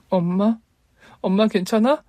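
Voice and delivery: put-on high anxious childlike call; a young girl afraid for her mother; worried little girl. A narrator's reading. Little daughter finding her mother crying, high and anxious.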